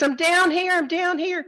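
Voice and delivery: high, urgent acted shout; hammed-up shout to be found; calling up. Jack calling up from the branch.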